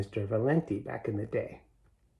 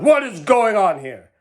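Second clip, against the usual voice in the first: loud, high angry demand; furious shout; 9 semitones higher, 10 dB louder than usual. A narrator's reading. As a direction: bellow it like an outraged store boss. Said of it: "charged with fury, shout".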